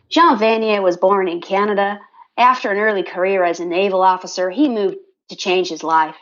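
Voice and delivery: put-on American accent; imitation American accent, reading aloud; American woman accent. Shown as a demonstration. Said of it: "do a Southern Am-, American woman accent".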